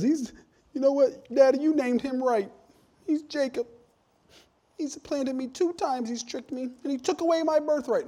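high, whining complaint to Daddy; whiny Esau. Retold or imitated. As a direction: whine like a cheated son. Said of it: "He says".

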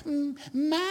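high, stretched-out wail of a call, imitated; child yelling for mother. Kids always calling for their mother, mock wail. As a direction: draw it out like a kid calling. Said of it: "they never yell".

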